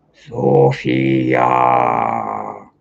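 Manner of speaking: deep, booming lion roar of a name. Retold or imitated. Giant lion roaring a name at her, deep and loud.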